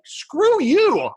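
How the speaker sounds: high mock yell at the teacher; retold yell. Telling off his son's teacher, a high mock yell. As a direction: fire it back like a retort you wished you'd said. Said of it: "And I'm like".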